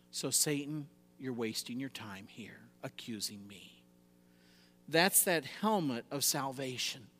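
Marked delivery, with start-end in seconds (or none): 0.1-3.8 low, soft send-off to an accuser; hushed, settled defiance; quiet dismissal. Acted. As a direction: dismiss him softly, sinking lower and quieter. Calm dismissal addressed to Satan.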